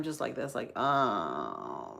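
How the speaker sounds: low, soft groan like dying batteries; slowing down, drained. Shown as a demonstration. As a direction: sink down low and quiet as if the power is running out. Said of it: "like the batteries are about to die".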